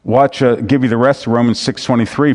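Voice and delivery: normal talking voice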